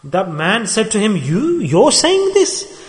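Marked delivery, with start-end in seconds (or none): 1.2-2.8 high, drawn-out disbelieving question; incredulous. Acted. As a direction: stretch it out slowly in astonishment. Man voices disbelief at the speaker.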